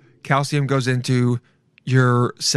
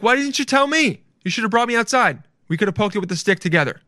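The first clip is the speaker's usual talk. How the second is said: high-pitched, eager complaint that eases down; mock-indignant playful parent; 7 semitones higher, faster than usual. Parent to daughter who found a dead squirrel, high mock indignation. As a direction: play a parent upset at being left out.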